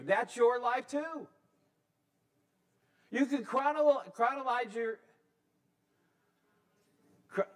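fervent sermon voice climbing high; preaching. Acted. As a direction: lift the pitch and preach it out. Preaching at full force.

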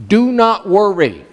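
slow, weighted "Do not worry"; emphatic command. Acted. Jesus commanding his followers, emphatic "Do not worry". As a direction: give each word of "Do not worry" its own weight. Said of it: "he said emphatically".

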